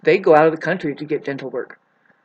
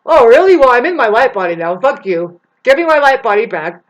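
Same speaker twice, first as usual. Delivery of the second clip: higher, loud indignant retort; mock outraged shout; 5 semitones higher, 8 dB louder than usual. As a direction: yell it back like you've been robbed. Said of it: "It's like".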